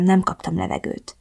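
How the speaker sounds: plain reading voice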